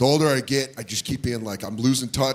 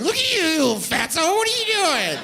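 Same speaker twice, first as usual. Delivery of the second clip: put-on baby talk to dog; 13 semitones higher than usual. Owner cooing at his fat little dog, high baby talk. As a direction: coo at the dog like a toddler. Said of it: "you gotta be like".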